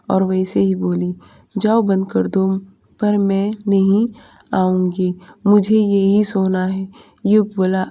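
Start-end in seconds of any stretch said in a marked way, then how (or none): none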